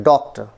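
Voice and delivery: Doctor with a prominent first syllable, falling away; doctor, strong first beat then a light ending; stress on first syllable. Shown as a demonstration. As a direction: punch the start of doctor. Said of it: "इसको मैंने prominent किया है".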